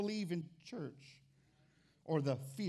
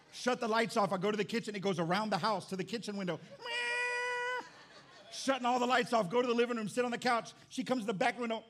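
frantic retelling; 7 semitones higher, 4 dB louder than usual. Retold or imitated. Cat follows him, frantic retelling.

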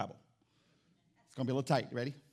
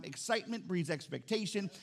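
ordinary delivery

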